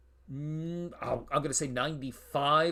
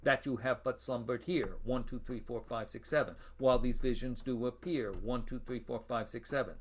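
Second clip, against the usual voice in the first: that you have but slumbered here, each syllable counted one to seven; syllable-counting recitation. Shown as a demonstration. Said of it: "seven syllables".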